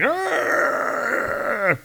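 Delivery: high mock roar of pain. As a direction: let out a cartoon cry of pain.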